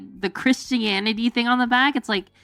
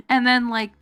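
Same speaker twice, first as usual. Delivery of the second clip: normal talking voice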